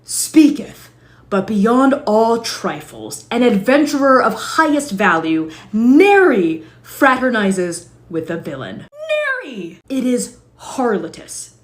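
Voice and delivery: grandiose archaic rant building to loud; pompous old-timey adventurer. Acted. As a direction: lecture like a self-important knight of old.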